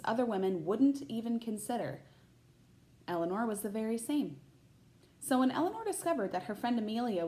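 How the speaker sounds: put-on bright, lively read-aloud voice for children; picture book narration; storybook reading. A narrator's reading. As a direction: read it like story time for a class.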